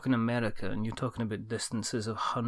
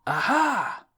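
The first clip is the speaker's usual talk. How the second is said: high, loud mock cry of triumph; triumphant cry; 12 semitones higher, 5 dB louder than usual. Imagining snatching chips from the seagull's beak, triumphant cry. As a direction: pounce with a playful "got it" shout.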